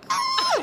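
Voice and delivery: very high, thin fangirl squeal; teen girl scream. Retold or imitated. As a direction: imitate a starstruck teenager squealing. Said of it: "teen girl scream".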